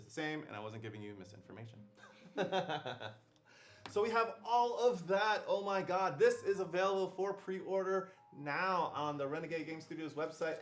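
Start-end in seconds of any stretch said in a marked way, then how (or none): 3.9-10.6 higher, punchy infomercial pitch; shopping-channel host. Acted. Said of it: "I feel like I'm one of those, like".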